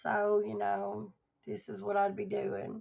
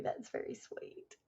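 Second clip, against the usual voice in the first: slow, much softer whisper, close to tears; choked-up whisper; 12 dB quieter than usual. Real, not acted. Thanking a viewer, emotional, in a choked-up whisper. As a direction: let it come out as a moved whisper. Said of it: "I'm very emotional right now".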